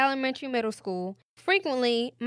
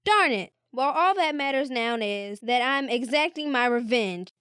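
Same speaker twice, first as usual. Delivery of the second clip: ordinary talk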